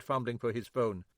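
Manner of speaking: ordinary delivery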